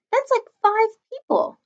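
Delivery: put-on high, slow, whiny kid's voice; upset kid's voice. A narrator's reading. Boy complaining to mom about guests, high whiny voice. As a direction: drag it out in dismay.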